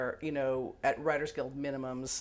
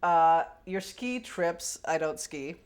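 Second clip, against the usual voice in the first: usual speaking voice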